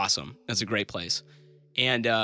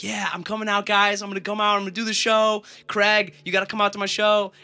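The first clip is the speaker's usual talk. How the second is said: excited; 8 semitones higher, 5 dB louder than usual. Retold or imitated. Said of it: "And I'm like".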